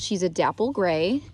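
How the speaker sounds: usual speaking voice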